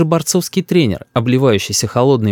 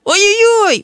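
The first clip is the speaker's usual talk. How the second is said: put-on very high-pitched child's howl; kicking-and-screaming yelp; child's wail; 3 dB louder, slower than usual. A narrator's reading. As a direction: wail like a boy being dragged off. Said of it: "вопит, брыкаясь, Рис".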